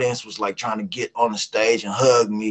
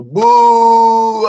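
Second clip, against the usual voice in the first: much higher, loud mock booing; imagined crowd boo; 10 semitones higher, 8 dB louder than usual. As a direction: boo him off like a hostile crowd. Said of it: "they was gonna go".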